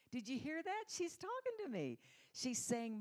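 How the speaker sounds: God overjoyed that his daughter talks to him; thrilled excitement. Acted. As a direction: squeal it like a delighted parent. God thrilled his child speaks.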